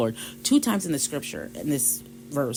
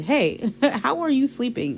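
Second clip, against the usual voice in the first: high, incredulous wake-up call with a laugh; laughing, high-pitched incredulity; amused disbelief; 10 semitones higher, 4 dB louder than usual. Acted. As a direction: rouse a sleeper in mock outrage. Sailors rouse sleeping Jonah.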